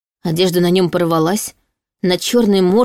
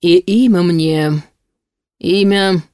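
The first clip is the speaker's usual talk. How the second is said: slow, drawn-out hesitation; faltering; slower than usual. A narrator's reading. Demon reaches for his forgotten name, faltering. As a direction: stretch the words as memory fails. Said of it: "Демон замешкался".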